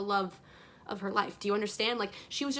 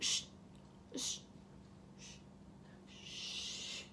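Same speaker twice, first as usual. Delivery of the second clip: repeated soft shushes, spaced apart; long quiet "shh" with pauses; imitated shushing; 15 dB quieter, slower than usual. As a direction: shush a whole crowd, fading away. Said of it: "he shushes everybody".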